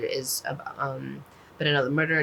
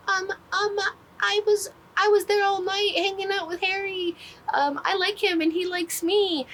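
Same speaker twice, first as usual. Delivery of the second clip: put-on much higher, bashful girly voice admitting a crush; a shy teen spilling her secret, way up high; giddy girl confessing. CP confessing about Harry, voiced high.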